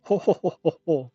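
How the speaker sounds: high little granny laugh; old granny chuckle. Acted. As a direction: chuckle like a sweet old lady. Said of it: "I have to do an old granny voice".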